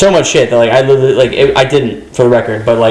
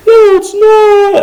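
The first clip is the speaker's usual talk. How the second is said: high, slow, wailing mock sob; imitating sobbing; 3 dB louder, much slower than usual. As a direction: wail it like a crying older coworker. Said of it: "and she's sobbing".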